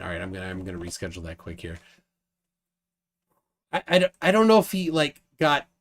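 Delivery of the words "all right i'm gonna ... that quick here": low, soft, fast talk to oneself; muttered aside. Real, not acted. Host busy rescheduling the call, muttered low and fast. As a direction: drop right down and hurry through it.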